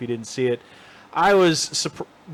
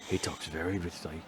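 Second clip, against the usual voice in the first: low, soft mock Caine; rich, lazy Caine imitation; Michael Caine impression; 8 semitones lower, 14 dB quieter than usual. [name] doing Caine again between shots, low and soft. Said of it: "And Michael Caine".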